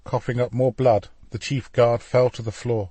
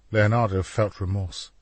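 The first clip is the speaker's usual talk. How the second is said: plain reading voice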